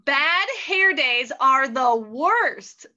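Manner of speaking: ordinary delivery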